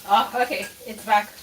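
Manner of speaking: ordinary delivery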